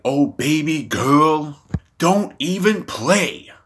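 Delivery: drawn-out, high-pitched sassy retort; imitated sassy clapback; sassy mock warning. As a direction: stretch it out slowly, swooping up high. Retelling AOC's sassy warning to MTG.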